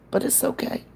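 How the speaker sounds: "but it's okay" breathed out high with no voice; a mock-hurt little whisper of "but it's okay". Teasing Kelly for ignoring her, high mock whisper.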